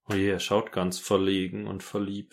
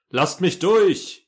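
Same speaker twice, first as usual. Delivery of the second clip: shouting; 8 semitones higher, 6 dB louder, much slower than usual. Pretend. Character forcing way through.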